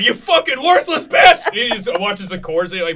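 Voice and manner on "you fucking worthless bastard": mock scream. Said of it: "You just scream like".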